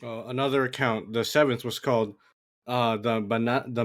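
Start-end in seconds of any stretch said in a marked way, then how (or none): none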